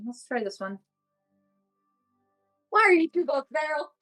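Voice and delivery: usual speaking voice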